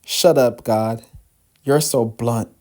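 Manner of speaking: usual speaking voice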